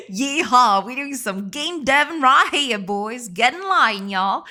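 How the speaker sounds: hammed-up Southern drawl with laughs; mock Texan cowboy calling the boys; Southern accent. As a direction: play the rowdy cowboy, laughing. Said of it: "Not even a bad Southern accent".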